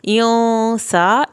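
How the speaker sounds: इयों with a long nasal hum before सा; teacher demonstrating ghunna on इयों; held nasal sound. Shown as a demonstration. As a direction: draw out the ghunna in इयों, very slowly. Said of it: "थोड़ी सी देर लगानी है".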